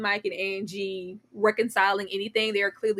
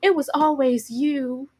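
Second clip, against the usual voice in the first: mock swooning reunion line; 4 semitones higher than usual. Mocking the show's couple's reunion, lightly hammed. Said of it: "to eventually go".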